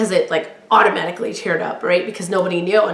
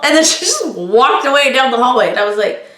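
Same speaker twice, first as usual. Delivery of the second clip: ordinary talk, nothing marked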